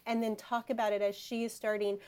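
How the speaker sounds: ordinary talk, nothing marked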